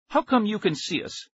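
high-pitched demanding question. A narrator's reading. Nicky challenging the stranger who sees them, demanding. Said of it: "Nicky demanded".

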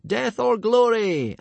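high, loud, slow chant; fanatical shout. A narrator's reading. Trainees answer their chief's salute, shouting fanatically. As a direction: shout it back with zeal. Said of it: "shouted fanatically".

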